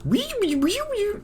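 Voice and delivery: very high sing-song wees; tiny high-pitched whee; high wee sounds. Pretend. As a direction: squeal it like a delighted kid. Playful noise watching animation.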